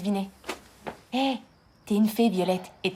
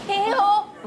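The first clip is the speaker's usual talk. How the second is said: high, loud hail; calling out; 10 semitones higher, 4 dB louder than usual. Acted. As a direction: call out across the room. Calling across a party.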